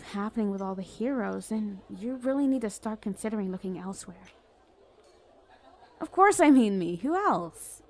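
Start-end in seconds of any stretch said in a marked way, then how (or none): 6.0-7.8 loud, higher exclamation; indignant. Acted. Villain proposing himself to crush, indignant. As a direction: blurt it out, affronted at being doubted.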